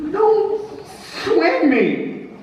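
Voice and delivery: high, slow, hungover growl of a general; sharp grumpy general. Acted. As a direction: snap at the aide like a sore-headed general. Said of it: "said Grant sharply".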